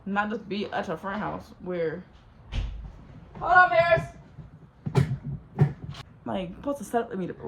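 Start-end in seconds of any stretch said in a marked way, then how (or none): 3.4-4.0 sudden loud, high shout aside; real calling out. Real, not acted. Storyteller interrupts herself to call to Paris, shouting. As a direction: break off and yell across to someone.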